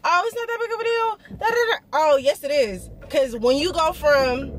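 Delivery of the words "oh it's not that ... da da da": high, fast dismissive mock voice; mimicking a doubter; mocking the viewers. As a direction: brush it off in a silly high voice. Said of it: "y'all probably thinking".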